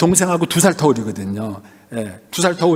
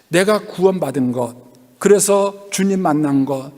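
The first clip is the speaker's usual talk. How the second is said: normal talking voice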